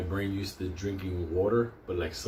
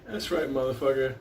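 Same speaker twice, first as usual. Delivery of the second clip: usual speaking voice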